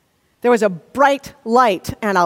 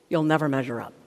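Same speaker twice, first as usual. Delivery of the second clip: low, quiet put-down; critical inner voice; 9 semitones lower, 6 dB quieter, faster than usual. Acted. Inner critic to a student with a worse grade, low condemning voice. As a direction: drop right down into a dark, soft verdict. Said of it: "this is the voice that says".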